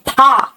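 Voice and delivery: फा with lips closing then bursting; Hindi aspirated ph. Shown as a demonstration. Teacher showing lips touching in Hindi, popped फा. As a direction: pop फा as p-h. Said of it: "ये दोनों होठ चिपकते हैं हिंदी में".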